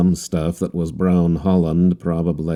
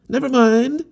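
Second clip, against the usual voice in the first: much higher voice, bright and cheering; encouraging. A narrator's reading. Harlequin cheering up the captain, high and encouraging. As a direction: lift the other man's spirits. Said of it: "he cried encouragingly".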